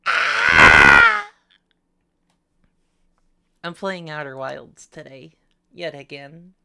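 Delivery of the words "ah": very loud, harsh mock scream; short full-force yell. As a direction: scream out in playful distress. Puppet screams at being hit.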